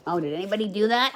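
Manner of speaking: usual speaking voice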